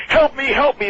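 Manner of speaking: high, loud, desperate cry for help; panicked plea. Retold or imitated. Paul begging Brian to pull the pencil out, panicked yell. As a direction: yell it like you are in real trouble.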